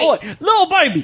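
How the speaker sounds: a high, hammed-up British "Oi"; mock British accent. Calling the cat over in a hammed-up Cockney accent. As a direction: call the cat in a jokey Cockney. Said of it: "I can only do an accent".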